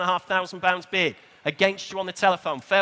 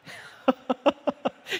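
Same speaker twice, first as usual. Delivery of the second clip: short, higher-pitched chuckle; laugh; 7 semitones higher than usual. Real, not acted. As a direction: break into a brief laugh. Auctioneer chuckles between bids.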